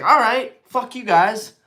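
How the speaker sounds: mock defiance at friends; defiant brush-off. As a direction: shrug them off and buy it anyway. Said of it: "I was like".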